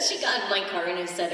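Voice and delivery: ordinary delivery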